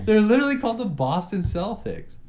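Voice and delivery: worked-up insistence. Real, not acted. Heated mock argument.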